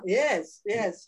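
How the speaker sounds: usual speaking voice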